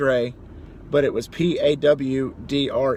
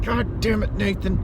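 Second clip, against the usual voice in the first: mock curse; 5 semitones higher than usual. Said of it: "cursing his name the entire day".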